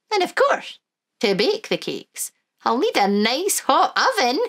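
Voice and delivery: put-on high, lilting old Scottish granny; Wee Granny voice. Granny to the children, high and fond.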